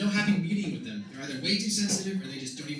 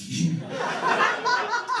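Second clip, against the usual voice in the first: loud high cackle; high shrieking laugh; 7 dB louder than usual. Real, not acted. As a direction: let out a shrill cackle. Laughs at own joke.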